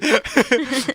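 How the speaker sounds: short high burst of laughter. Real, not acted. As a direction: laugh it off, caught out. Burst of laughter mid-story.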